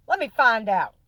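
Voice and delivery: loud, high mocking reply; cocky playful dare; voicing him. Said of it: "he was like".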